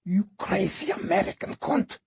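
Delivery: high, venomous hiss of an insult. A narrator's reading. Radu Fortuna cursing Kate, hissing with hate. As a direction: spit it at her like venom. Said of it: "hissed Radu Fortuna".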